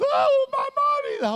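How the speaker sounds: put-on very high, slow child voice; child's cry. A little child spots its mother, high and relieved. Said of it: "it's like the child".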